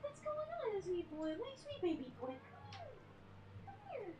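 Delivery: very high, soft pet voice; high soft cooing to the kitten. Real, not acted. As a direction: coo at the cat, soft and high. Baby talk to her cat.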